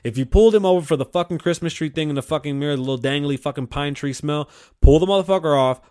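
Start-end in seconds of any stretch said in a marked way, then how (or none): none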